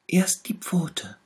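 high, pitiful little tiger voice; sick little tiger. A narrator's reading. Little tiger shows the bear where it hurts, high and pitiful. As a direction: whimper it, poor sick cub. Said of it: "sagte der kleine Tiger".

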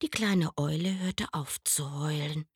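ordinary delivery

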